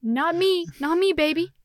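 high, loud mock refusal; emphatic refusal. Podcaster swearing off ASMR crushes, loud and emphatic. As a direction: belt out a playful, adamant no.

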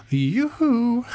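high, teasing call of yoo-hoo; sing-song yoo-hoo. A narrator's reading. Magician taunting the Keeper from behind, high sing-song call. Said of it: "the magician exclaimed".

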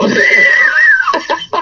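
high burst of laughter. Real, not acted. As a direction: shriek with laughter.

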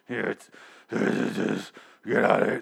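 throaty, strangled rasp with stuttering starts; exaggerated croak, as if needing a lozenge; hoarse Daryl. Daryl lets Dwight go, croaking hoarse threats. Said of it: "Do you want a lozenge?".

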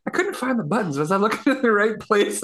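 a hint of a bewildered buddy asking; confused Cody. Friend asking where the buttons were, mildly puzzled voice. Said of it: "He's like".

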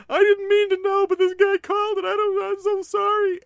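put-on very high, loud panicked boyish confession; a boy owning up to his parents, high and loud; guilty kid confessing. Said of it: "before I went to my parents going".

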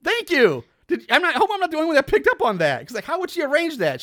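high, fast excited agreement. Real, not acted. Excited agreement, higher and louder.

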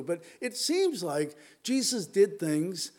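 seems held long and slow in the phrase; a slow, weighted seems in an otherwise even line; stress on seems. Shown as a demonstration. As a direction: linger on seems to signal doubt. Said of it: "I'm emphasizing the word seems".

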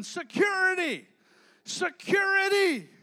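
loud, high, drawn-out yell for security; retold yell. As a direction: holler it slowly like a trapped kid calling for help. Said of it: "he kept yelling".

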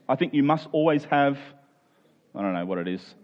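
ordinary talk, nothing marked

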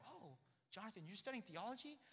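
lightly voiced dad, mildly surprised; imitating dad. Quoting dad finding packet.

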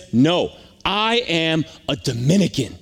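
slow, emphatic "I am", each part set apart; emphatic retort. Retold or imitated. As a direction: imitate a slow, deliberate correction stressing "I am". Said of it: "he was emphatic about that".

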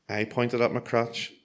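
usual speaking voice

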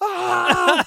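high, loud imitated scream; freaked-out "ah" acted out; hammed-up cry of panic; mock scream. Retold coworker screams in freak-out.

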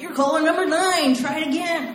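radio DJ. Retold or imitated. Radio DJ telling caller she missed, chirpy.

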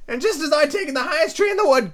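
very high, fretful bird voice; ranting pigeon. Acted. Pigeon ignoring Alice, high and aggrieved. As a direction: rant on in a high squawk.

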